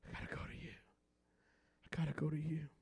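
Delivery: breathy whispered plea; whispered prayer. Acted. Pastor repeating his prayer, whispered.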